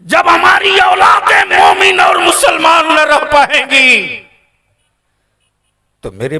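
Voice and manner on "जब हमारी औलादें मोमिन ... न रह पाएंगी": high, loud preaching shout; fiery preaching. Acted. Preacher warning the gathering about their children, fiery shout. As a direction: cry it out to the crowd.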